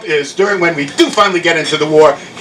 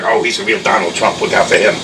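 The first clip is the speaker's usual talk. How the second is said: much lower-pitched voice; muttered-aside quote; 10 semitones lower than usual. Acted. Comedian quoting a future saying about a traitor, low aside. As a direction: drop the pitch right down for the quote.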